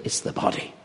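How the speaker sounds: whispered, breathy emphasis; hushed whisper. Shown as a demonstration. Preacher whispering the point to the congregation. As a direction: breathe it out like a secret. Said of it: "You don't stand up as a spirit".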